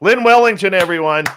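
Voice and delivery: high, loud shout-out; announcing a name. Real, not acted. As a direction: call her name out like an emcee. Host calls out departing guest.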